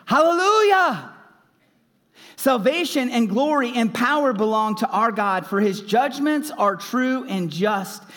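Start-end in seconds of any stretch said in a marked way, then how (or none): 0.0-5.1 high, loud hallelujah cried out; exultant shout of praise; loud cry. A narrator's reading. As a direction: cry it out like a great crowd. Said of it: "loud voice of a great multitude ... crying out".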